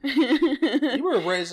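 slow, loud, laughing jab; laughing tease. Real, not acted. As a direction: drag it out while laughing. Teasing friend, laughing.

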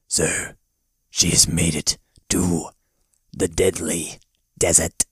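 low, slow, spaced-out report; rock-face spy. Retold or imitated. Rock face reporting to its master, low and halting. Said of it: "there'll be this face, and at first we hear it".

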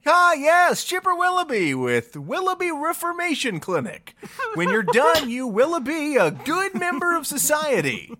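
high, laughing salesman voice; cheery high pitchman; character voice. Acted. Chipper introducing clinic.

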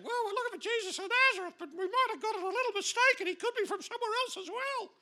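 very high, fast babbling backtrack; panicky falsetto. Acted. Crowd flat on the ground answering Jesus, panicky falsetto. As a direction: gabble it in a squeaky mock-scared voice.

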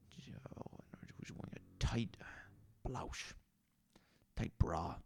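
hushed lecherous whisper; leering whisper. Acted. Barfly whispers leeringly about the woman at the bar. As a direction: whisper it like a dirty secret.

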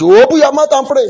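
high, loud command; urgent call. Real, not acted. Preacher ordering the congregation to pray, loud urgent call. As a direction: call out over a praying congregation.

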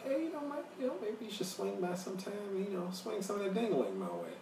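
a light imitation of a singer flirting.

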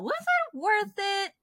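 high, drawn-out disbelief; incredulous. Real, not acted. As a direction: pitch it way up in disbelief. Incredulous rhetorical question.

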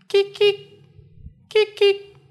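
high chirping cricket call. Acted. Cricket noise in the wheat.

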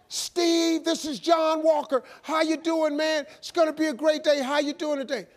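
high, chipper morning caller; relentless upbeat wake-up call; John Walker. Retold or imitated. Sponsor calling a sleeping man at five thirty, chipper. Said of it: "he called me the exact same way".

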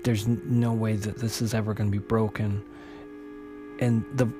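ordinary delivery, nothing marked

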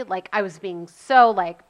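usual speaking voice

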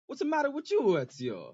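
mock Italian accent, high start. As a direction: ham up an Italian game voice. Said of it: "in an Italian accent".

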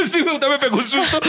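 high, laughing through the words; laughing relief. Retold or imitated. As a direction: laugh off the scare. Relieved laugh retelling fright. (riendo aliviado, voz aguda)

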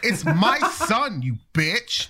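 slow, high, laughing through it; laughing retort. Retold or imitated. Father telling the lady off while laughing. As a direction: toss it back with a laugh. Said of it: "And I'm like".

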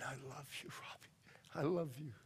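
very soft, low imitation of a weeping dad; retold as a hushed, choked-up murmur; tearful father, retold. Father hugging his son and crying over him, softly tearful. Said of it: "he's crying over me".

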